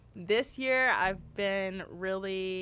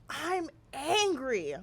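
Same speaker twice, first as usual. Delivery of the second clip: put-on slow, higher, sulky little kid voice; pouting toddler; 5 semitones higher, much slower than usual. Mother imitating her son saying he is angry, slow sulky. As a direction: mimic a two-year-old declaring it slowly. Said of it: "He goes".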